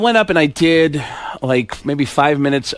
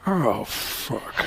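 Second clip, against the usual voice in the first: low, soft muttered dread; Al Pacino; 4 semitones lower, 12 dB quieter than usual. Acted. Pacino spotting Baldwin from the elevator, low dismayed mutter. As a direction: mutter it under your breath, sinking. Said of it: "the Pacino looked and went".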